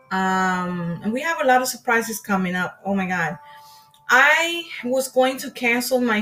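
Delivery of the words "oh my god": ordinary talk, nothing marked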